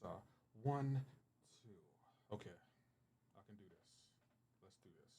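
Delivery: hushed, calming himself before a fake heartfelt speech; psyching up quietly. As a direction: breathe and steady yourself, almost under your breath. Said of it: "Get my sensitive face on".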